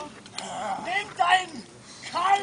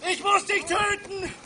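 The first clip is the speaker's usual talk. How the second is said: ordinary delivery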